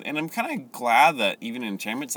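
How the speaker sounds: normal talking voice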